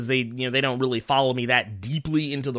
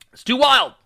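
higher, louder exclaim; excited; 10 semitones higher, 6 dB louder than usual. Real, not acted. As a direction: blurt it out with excitement. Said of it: "repeats louder".

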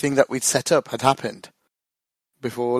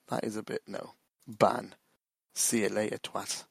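much softer, muttered off to the side; quiet aside; 2 semitones lower, 9 dB quieter than usual. Real, not acted. Host banning a Discord troll mid-recording, softly muttering. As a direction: grumble at the troll under your breath.

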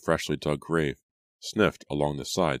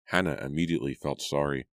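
usual speaking voice

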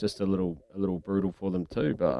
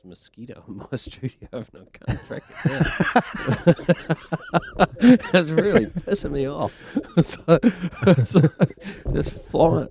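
breaking into real laughter, higher; laughing; 8 semitones higher, 4 dB louder, slower than usual. Real, not acted. Host complaining about a mosquito in the studio, laughing. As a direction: laugh helplessly through the complaint.

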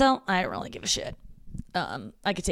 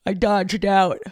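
louder words pushed through a yawn; 2 semitones higher, 6 dB louder, slower than usual. Real, not acted. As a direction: yawn while saying it. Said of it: "I'm sorry to keep yawning".

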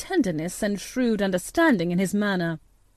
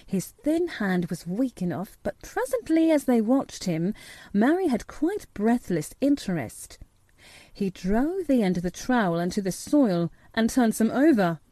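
plain reading voice

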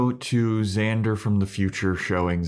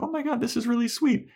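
touched wife. Retold or imitated. Wife voiced in a retold scene.

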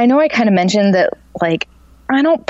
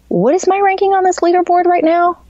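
high-pitched incredulous question; retold self-questioning; 14 semitones higher than usual. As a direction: replay checking yourself in a raised voice. Retold question to herself on bike.

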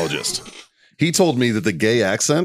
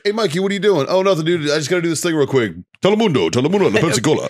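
mock San Antonio accent; 5 semitones higher, faster than usual. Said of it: "it's like".

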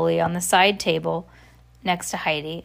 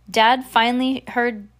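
ordinary delivery

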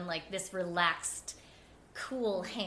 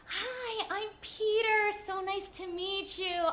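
high, sing-song imitation of a cheery greeter; choreographer Peter greeting; 10 semitones higher, slower than usual. Choreographer greeting the new swing, high chirpy imitation. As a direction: play him bright and overly friendly, much higher.

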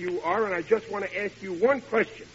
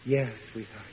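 much softer, lower, lost in memory; dreamy reminiscing; 7 semitones lower, 8 dB quieter than usual. Acted. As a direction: sigh it like a fond memory. Husband drifts into romantic memories.

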